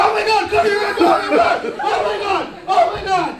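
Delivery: high, hammed-up panic; freaking out. Two brothers panicking over the tape, freaking out. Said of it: "Me and my brother are freaking out".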